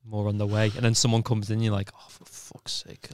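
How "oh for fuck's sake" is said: hushed, whispered mock despair; exasperated whisper. In the cubicle when someone comes in, exasperated whisper. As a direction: whisper it as a private curse. Inner groan in toilet cubicle.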